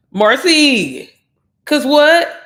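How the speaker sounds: loud, high-pitched challenge; calling someone out. Real, not acted. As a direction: holler the name, then demand an answer. Calling out a viewer.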